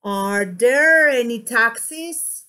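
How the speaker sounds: drawn-out "there", rising finish on "taxis"; question intonation. Shown as a demonstration. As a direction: stretch "there" and lift "taxis". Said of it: "You see that?".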